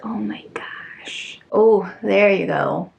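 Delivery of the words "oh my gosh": slow, very soft whisper of awe; awed whisper. Real, not acted. Seeing the second variant, whispering in awe. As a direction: barely breathe it out in wonder.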